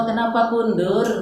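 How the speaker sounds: high, mildly playful mock question; mock puzzled question. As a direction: ask it like a baffled learner. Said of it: "Kayak orang Jawa".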